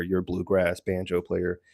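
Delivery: usual speaking voice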